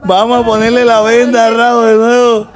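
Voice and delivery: normal talking voice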